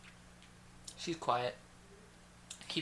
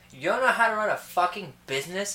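mock scolding; 5 semitones higher, 7 dB louder than usual. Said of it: "Voices were spot on".

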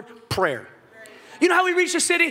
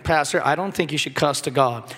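prim churchgoer; 11 semitones lower than usual. Retold or imitated. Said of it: "Some of you are like".

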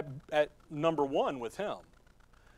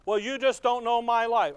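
mock objector; 6 semitones higher, 6 dB louder than usual. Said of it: "voicing a complaining objector".

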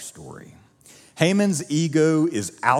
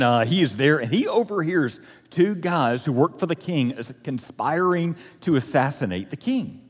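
normal talking voice

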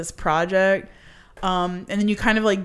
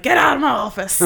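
put-on gruff old rich man, louder; gravelly old tycoon; 6 semitones higher, 6 dB louder, faster than usual. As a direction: bark it like a mean boss. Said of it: "and he's like".

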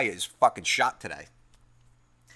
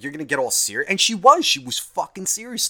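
ordinary talk

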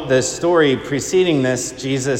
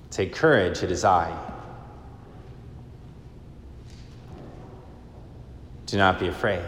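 quieter, lower, tender reassurance; soft reassurance; 4 semitones lower, 11 dB quieter than usual. Retold or imitated. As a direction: say it softly, like a comfort. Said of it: "words that we need to hear".

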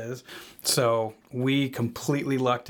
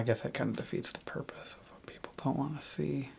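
whispered; 9 dB quieter than usual. Acted. Aside muttered at funeral.

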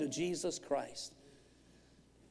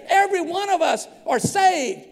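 high, loud, driving sermon voice; full-force preaching; 9 semitones higher, 7 dB louder than usual. Real, not acted. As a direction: preach it out urgently to the congregation.

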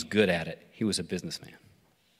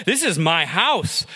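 slow, raised, indignant protest; husband protesting to his wife, indignant and raised; 7 semitones higher, 4 dB louder, slower than usual. Acted. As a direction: insist it's your house. Indignant reply in argument.

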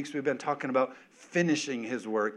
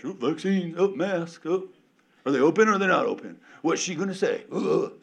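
mocking gossip; 4 semitones higher, 5 dB louder than usual.

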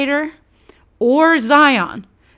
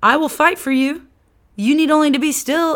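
higher, friendly and eager; eager God; 6 semitones higher than usual. Retold or imitated. God calling Israel to trust him, higher and eager. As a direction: wave them over, keen to help. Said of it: "God all along's like".

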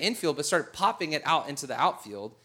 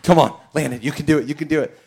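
cheering on; 2 semitones lower, 6 dB louder, faster than usual. Retold or imitated. Dad cheering son at bat.

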